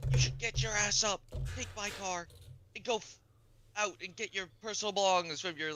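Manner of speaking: high, loud nagging dad; mock dad voice. Quotes dad ordering him about.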